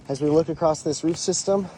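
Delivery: ordinary delivery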